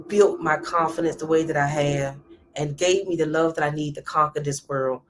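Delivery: ordinary talk, nothing marked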